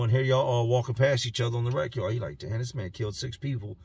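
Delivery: ordinary delivery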